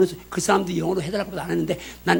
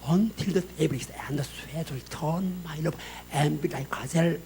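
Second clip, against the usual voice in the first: slow, soft English recitation of love poetry; scripture recitation; 3 semitones lower, 9 dB quieter, much slower than usual. Shown as a demonstration. Pastor showing the congregation Song of Solomon in English, slow soft recitation. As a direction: recite it hushed and unhurried, sinking low at line ends.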